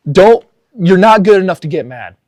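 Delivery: emphatic punchline. Real, not acted. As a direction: land grandpa's line as the lesson. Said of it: "repeating grandpa's line as her message".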